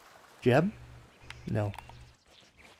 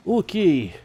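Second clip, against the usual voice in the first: put-on Russian accent; 5 semitones higher than usual. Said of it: "my Russian accent".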